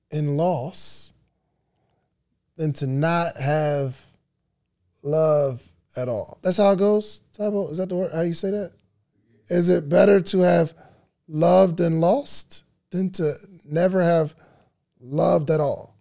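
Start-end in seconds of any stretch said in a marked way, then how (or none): none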